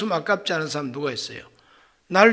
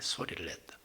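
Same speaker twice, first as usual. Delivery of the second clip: whispered; 18 dB quieter than usual. Shown as a demonstration. Preacher whispers it to show the wrong way to pray. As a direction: whisper it under the breath, barely audible. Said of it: "그런 소리로 하나님 앞에 말씀드린 게 아니에요".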